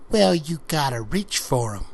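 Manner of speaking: a lightly voiced coy girl, drawing it out; teasing challenge. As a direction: dare him to reach, playful and sly. Megan teasing the hired hand.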